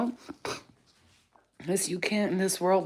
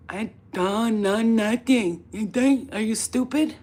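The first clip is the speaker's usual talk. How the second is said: slow, loud, higher and scornful; defiant to cops; 4 semitones higher, 5 dB louder, slower than usual. Pretend. Facing the cops' questions, slow scornful defiance. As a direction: sneer at them, taking your time. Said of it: "I'd be like".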